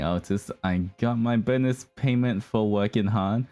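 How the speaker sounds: ordinary talk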